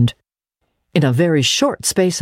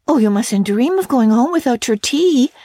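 in distress; 9 semitones higher than usual. Acted. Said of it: "cried Anne in distress".

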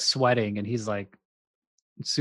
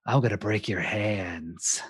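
slow, lower hammed-up thug threat; overacting thug threat; 4 semitones lower, 5 dB quieter, slower than usual. Retelling the overacting mafia boss's threat, slow hammy menace. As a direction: ham up a menacing threat, slowly. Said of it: "he's really overacting every moment".

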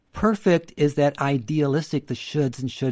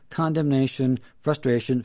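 ordinary talk

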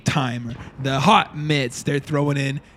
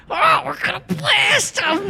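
very high, slow, gleeful shout; high manic NPC voice; slower than usual. Acted. GM voicing a random NPC in his go-to high voice. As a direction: cackle out the threat, way up high. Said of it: "I feel like Chris".

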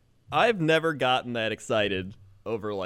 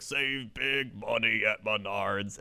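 ad jingle voice; 5 semitones lower, 4 dB quieter, slower than usual. Retold or imitated. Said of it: "they're just like".

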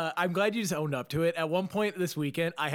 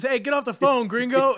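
much higher and louder mock guard shout; mock prison yell; 9 semitones higher, 8 dB louder than usual. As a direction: bark it at him like an annoyed inmate, half laughing. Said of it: "Everyone goes".

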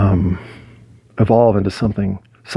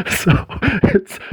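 high giggle. Real, not acted. Laughs at frozen trash can.

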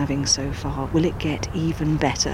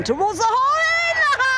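building to a shouted high call of the chip-in; climbing into an ecstatic shout as the ball drops; excited commentary call; 4 dB louder, faster than usual. Real, not acted. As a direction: rise from calm calling to a thrilled yell.

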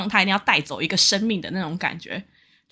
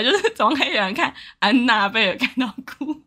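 ordinary delivery

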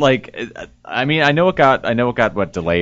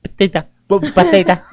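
higher, mock Cuban accent; 5 semitones higher than usual. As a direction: tease him with a Cuban accent. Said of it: "it's your Cuban accent".

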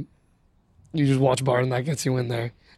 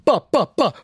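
DJ horn imitation; 9 semitones higher than usual. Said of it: "sounded like a goat just got kicked".

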